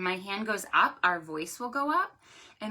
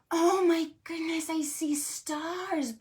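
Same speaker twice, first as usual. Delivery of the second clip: high, wonderstruck exclamation; high-pitched, hammed-up awe; playful amazement; 7 semitones higher than usual. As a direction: gush in pretend wonder at a marvelous sight. Pretend amazement at seeing stars.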